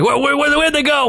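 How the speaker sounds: high, stammering panic; freaking out. Retold or imitated. Frantic stranger questions men in a truck, freaking out. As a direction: imitate a frantic man, tripping over the first word. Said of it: "He's freaking out".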